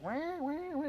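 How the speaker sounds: high, drawn-out parrot squawks; imitated bird squawk. Player imitating his guild mate's noisy bird, squawking. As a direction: mimic a squawking pet bird. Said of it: "his bird would just be like".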